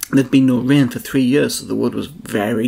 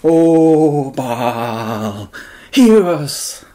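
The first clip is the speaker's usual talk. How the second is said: loud, rising cry of O Baal, hear us; shouting; 2 semitones higher, 6 dB louder, much slower than usual. Acted. Prophets crying out to Baal, loud shout. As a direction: call out to a silent god at full voice. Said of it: "they shouted".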